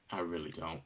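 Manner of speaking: low, very soft retold deadpan; unimpressed reply. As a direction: drop it down, barely interested. Acted unwilling reply to driver.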